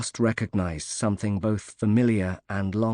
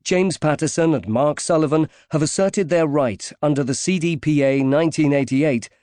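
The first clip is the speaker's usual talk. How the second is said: higher, louder announcer read of the credits; formal closing credits announcement; audiobook credits read; 6 semitones higher, 7 dB louder than usual. Acted. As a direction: announce the publisher and copyright crisply.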